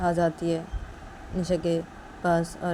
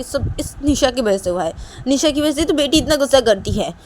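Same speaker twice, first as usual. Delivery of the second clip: loud, raised-pitch blame; accusing Manisha; 7 semitones higher, 7 dB louder than usual. Acted. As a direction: lay the blame loudly, in a huff. Manisha blaming Nisha in acted quarrel.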